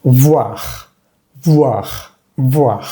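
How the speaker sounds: "voir" as vwa plus throaty R; final French R. Shown as a demonstration. Teacher models final R, "voir" as vwar. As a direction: end "voir" on a breathed throat rasp. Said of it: "Y la R que sigue".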